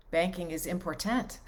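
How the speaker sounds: im-por-TANT, last syllable punched and rising; stress on last syllable of IMPORTANT. Shown as a demonstration. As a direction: hit the tant of important, full vowel like tent. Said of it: "emphasis on certain syllables and things".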